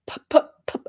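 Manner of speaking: stammered p sounds, breathy; nervous stutter. Acted. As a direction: stutter on the p, flustered. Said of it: "I'm already stuttering".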